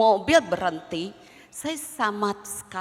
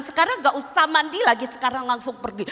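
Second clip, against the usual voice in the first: fast, louder scolding of a daughter; scolding mother; 5 semitones higher, 5 dB louder, faster than usual. Retold or imitated. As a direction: snap at her to just go now. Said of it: "Saya langsung ngomel". (ibu memarahi anaknya dengan cepat dan keras)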